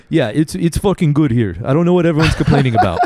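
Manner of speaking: hammed-up Albanian driver, a touch of Albanian accent; terrible Albanian accent. Quoting the Albanian Uber driver in a terrible Albanian accent. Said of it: "That was probably a terrible accent".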